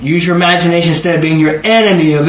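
usual speaking voice

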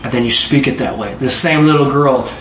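usual speaking voice

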